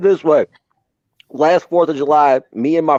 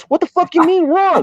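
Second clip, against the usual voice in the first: higher, alarmed question; alarmed disbelief. Retold or imitated. Told to run from the box, he asks his buddy higher and alarmed. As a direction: act out the panic.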